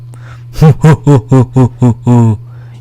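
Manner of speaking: loud, repeated "hoo hoo hoo" hoots; string of loud hooted laughs; booming mystery call. Retold or imitated. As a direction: boom out an ominous, echo-like laugh. Imitates mysterious sound heard from nowhere.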